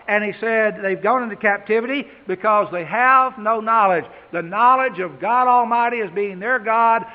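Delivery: loud, driving preacher voice, leaning on knowledge; preaching at full force. Acted. Preacher warning his congregation, loud and driving. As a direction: hammer it home from the pulpit.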